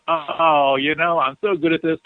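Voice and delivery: mock smug, high lazy voice; hammed-up complacent slacker; smug lazy self. Flattered [name] brushing off work, hammed-up smug voice. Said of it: "And I go".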